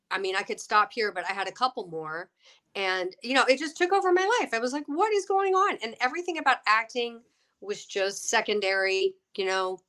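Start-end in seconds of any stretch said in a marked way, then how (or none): 4.9-5.8 bewildered. Retold or imitated. Bewildered quoted thought.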